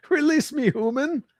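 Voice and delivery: high mock cat voice demanding release. Voicing the cat's demand.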